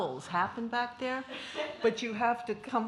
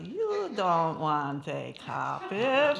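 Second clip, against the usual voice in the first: slow lead-in; 3 dB louder, much slower than usual. Shown as a demonstration. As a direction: draw out You want an so the room can finish it. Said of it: "I'll say the first part".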